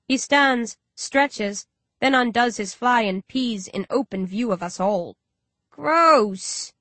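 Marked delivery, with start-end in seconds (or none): 5.7-6.7 higher, louder retching cry; disgusted gag. A narrator's reading. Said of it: "Carl gags".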